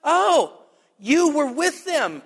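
lightly voiced servant girl. Little servant girl spotting Peter, lightly voiced accusation. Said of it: "Just this little girl that says".

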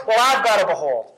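ordinary delivery

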